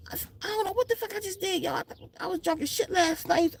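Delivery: high, soft, rushed confusion; dazed confused friend. Retold or imitated. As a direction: voice a bewildered hungover guy.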